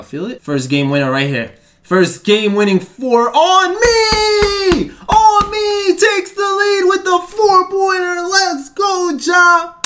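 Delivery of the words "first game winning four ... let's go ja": very high, loud hype call; ecstatic scream. Real, not acted. Gamer hits game-winning four to take the lead, ecstatic scream. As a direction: explode into a drawn-out celebration scream. Celebrating go-ahead four-pointer.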